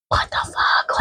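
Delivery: exasperated whisper to the viewers; whispered aside. Real, not acted. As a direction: hush it like a secret curse.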